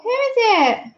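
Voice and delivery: high-pitched coo to a dog; excited pet talk. Real, not acted.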